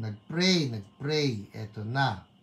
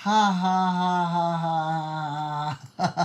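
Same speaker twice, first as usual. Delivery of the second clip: long read-out laugh; 3 semitones higher, 5 dB louder than usual. Acted. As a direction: act out a long written laugh. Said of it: "Mahaba rin yun". (mahabang binasang tawa)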